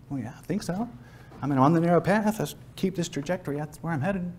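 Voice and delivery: offhand reply. Acted. Gillen answers whether he is saved.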